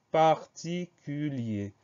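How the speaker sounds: slow, deliberate dictation; syllable by syllable. Shown as a demonstration. Teacher presenting a long word to spell, syllable by syllable. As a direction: space out each syllable of "particulier".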